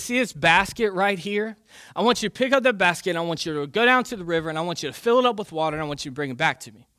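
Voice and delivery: rapid-fire instructions. Acted. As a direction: reel off the chores, speeding up. Grandfather gives grandson instructions.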